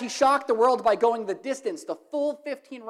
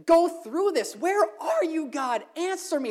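higher, lightly pleading prayer; a touch of desperate prayer; pleading with God; 6 semitones higher than usual. Retold or imitated. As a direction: cry out to God, pitched up. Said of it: "I found myself asking".

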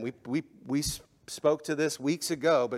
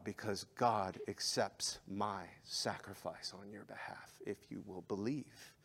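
tender Jesus voice; 4 semitones lower than usual. Acted. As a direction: speak it gently as Jesus offering grace. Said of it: "And Jesus is saying".

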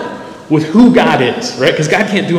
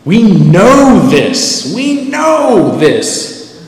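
slow mock insistence; 5 semitones higher, 3 dB louder, much slower than usual.

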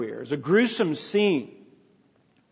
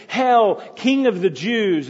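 loud, slow, jeering salute; mocking hail; 5 semitones higher, 5 dB louder than usual. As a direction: sneer it out like a taunting soldier. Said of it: "And they mocked".

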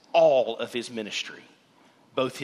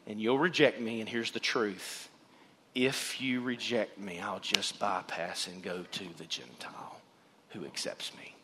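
fading hush; 8 semitones lower, 11 dB quieter than usual. Acted. Jesus warning his hometown, fading from soft to whispered. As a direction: voice Jesus softer, sinking to a breathless whisper.

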